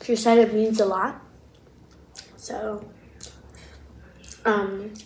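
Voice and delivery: chewing between words; talking around food; mouth full. Real, not acted. Seen 2: Closed puffed cheeks, chewing motions, tongue licking lips through the words.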